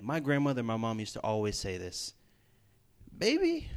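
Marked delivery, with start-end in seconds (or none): 3.2-3.7 put-on high, sweet old grandma calling baby; grandmother's voice. Preacher imitating his grandmother's high coo to the congregation. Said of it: "I'm not gonna say it in that voice".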